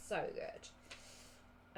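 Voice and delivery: breathy whispered so good, drawn out. Shown as a demonstration. Telling viewers how good Monster Munch is, whispered. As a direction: savour it in a whisper. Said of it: "They are".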